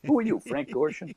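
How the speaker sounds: mock-flippant question. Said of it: "Just flippantly".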